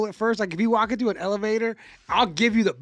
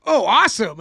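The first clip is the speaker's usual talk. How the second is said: loud, higher-pitched eager reply; mock delight; 4 semitones higher, 9 dB louder than usual. Stranger welcoming elevator small talk, hammed-up delight. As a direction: play someone thrilled to be chatted up. Acting people welcoming the banter.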